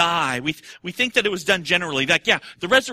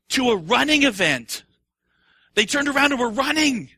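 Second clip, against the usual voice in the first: rising excitement; 7 semitones higher, 4 dB louder than usual. Acted. Preacher builds to excitement.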